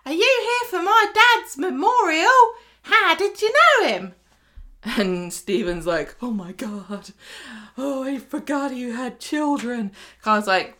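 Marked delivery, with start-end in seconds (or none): 0.1-4.1 hammed-up orphan child, very high and loud; high-pitched mock waif hailing the grown-ups; small orphan. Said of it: "along comes a small orphan". 6.2-9.9 slow, lightly acted stunned realisation; understated, drawn-out mock horror; mock dismay. Retold Stephen taken aback by orphan.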